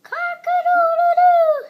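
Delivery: very high, loud rooster crow; full-throated cock-a-doodle-doo. Acted. Child imitating the rooster crow.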